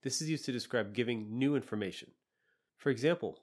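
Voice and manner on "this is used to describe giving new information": usual speaking voice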